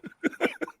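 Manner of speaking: breathless high giggle; real laughter cracking up; laughing. Real, not acted. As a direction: burst into a squeaky laugh. Laughing mid-story.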